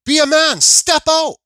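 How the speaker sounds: very high, loud command; retold angry dare. Driver daring the man to step out, retold angry dare. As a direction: dare him out, replaying it at full volume.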